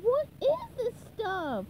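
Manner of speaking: high, slow, puzzled question; high cartoon puzzlement; SpongeBob. A narrator's reading. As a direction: ask in wonder, stretching the words. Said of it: "SpongeBob asked".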